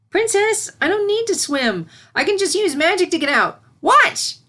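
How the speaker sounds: high, loud, proud little voice; eager filly. Acted. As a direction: show off the plan to her teacher. Filly proudly shows off her spell.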